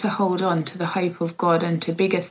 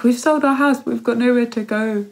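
higher-pitched burst of mock panic; retold panic; 5 semitones higher, 3 dB louder than usual. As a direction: voice your own little panic, pitched up. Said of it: "I've had a little panic".

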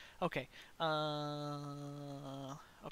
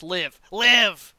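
shouting; 3 semitones higher, 18 dB louder than usual. Real, not acted. Urging teammate to survive.

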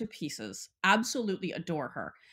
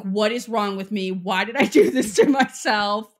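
loud, exasperated mock outburst; acted shouting; 8 dB louder than usual. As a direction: shout it at yourself, building louder. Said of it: "like, shouting".

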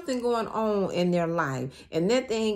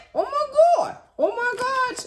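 high, loud squeal of disbelief; high-pitched excited outburst; shocked squeal; 14 semitones higher, 6 dB louder than usual. Real, not acted. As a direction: shriek it like you can't believe your eyes. Reader reacts to the card.

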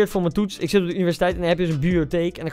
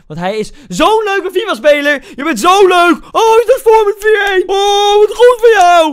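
high, loud sarcastic gushing; fake-cheer the opponent in a high shrill-free squeal; mock praise; 13 semitones higher, 10 dB louder than usual. Mocking the opponent's lucky goal, loud high sarcasm.

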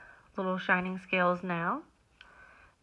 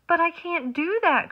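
high, loud wailing protest; upset cry; 10 semitones higher, 8 dB louder than usual. Acted. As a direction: cry out in dismay. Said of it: "cried the rainbow fish".

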